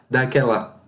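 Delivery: ordinary talk, nothing marked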